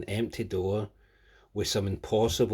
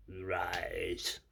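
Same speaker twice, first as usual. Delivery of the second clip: lower, slow Emperor voice; dark lord command; 4 semitones lower, much slower than usual. Pretend. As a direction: command the apprentice to rise. Star Wars "rise, Lord Vader.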